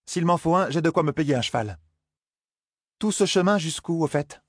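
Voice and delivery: put-on Zarine, wry young woman. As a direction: tease him for his grip.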